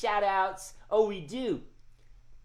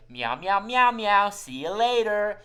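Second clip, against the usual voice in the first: leading group meows; 5 semitones higher, 8 dB louder than usual. Pretend. Host leads children's goodbye to the cat, swelling meows. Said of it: "And say".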